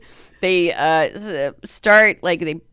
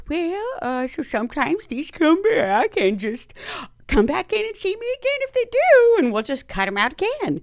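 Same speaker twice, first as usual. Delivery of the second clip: higher sing-out voice, pitch leaping midway; breezy doctor impression; 9 semitones higher than usual. Doctor at the end of it, breezy higher-pitched advice. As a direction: imitate a doctor casually dismissing the patient. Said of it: "she goes".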